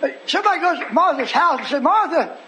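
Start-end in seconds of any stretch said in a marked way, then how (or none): 1.8-2.4 hammed-up, higher-pitched stammering alarm; agitated messenger. Neighbour warning Martha about Mary, agitated. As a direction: play a flustered bearer of news, pitched up.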